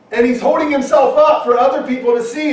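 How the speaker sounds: normal talking voice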